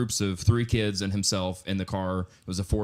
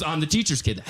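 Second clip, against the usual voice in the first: ordinary delivery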